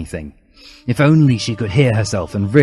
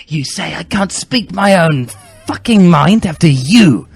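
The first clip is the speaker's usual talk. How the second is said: much higher and louder, hammering every swear; furious shout; 6 semitones higher, 6 dB louder than usual. Acted. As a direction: shout her down. Continued furious argument.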